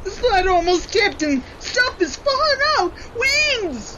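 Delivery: high, tearful panic; crying out in alarm; panicked. Acted. Sled tipping on descent.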